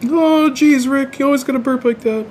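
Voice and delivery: high-pitched whiny cartoon impression; cartoon sidekick. Cartoon sidekick bit after a burp.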